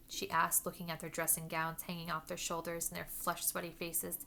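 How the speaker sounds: usual speaking voice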